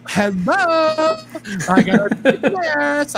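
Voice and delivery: high, loud camp voice with a laugh; mock effeminate voice. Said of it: "make you go".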